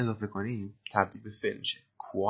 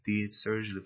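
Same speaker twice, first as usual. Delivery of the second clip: slow repeat; much slower than usual. Shown as a demonstration. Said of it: "teacher repeats line slowly".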